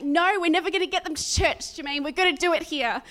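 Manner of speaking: loud, high, urgent overruling; insistent retort. Retold or imitated. Overruling Jermaine about bringing them to church, loud insistence.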